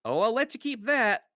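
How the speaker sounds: high, loud gruff mercenary captain voice, teasing; mercenary leader tossing off a loud, mocking concession; Cudgel's voice. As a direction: grant it with a sly, loud lift. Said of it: "Cudgel just says".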